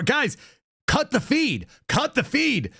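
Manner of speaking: high, fast, frantic newscaster voice; panicked anchor. Retold or imitated. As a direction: play a flustered anchor losing it. Continues panicked anchor impression.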